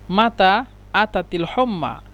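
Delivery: মাতা আতাতিল হম্মা drawn out slowly; slow teaching take; slow repeat. Shown as a demonstration. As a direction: spell it out slowly for the student. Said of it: "এখানে আরবি শুদ্ধ ভাষা হলো".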